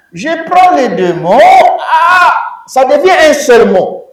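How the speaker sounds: high, loud excited exclamation; excited reveal. Acted. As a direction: announce the merge with a loud cry. Teacher dramatizes the merge. (révélation enthousiaste, forte et aiguë)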